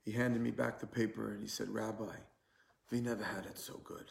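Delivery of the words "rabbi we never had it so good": softly imitated Polish accent; quiet Polish-accented English, retold; heavy Polish accent. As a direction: lightly voice an old Polish man, quietly. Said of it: "in his heavy Polish accent".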